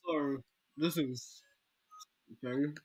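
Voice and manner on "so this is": ordinary talk, nothing marked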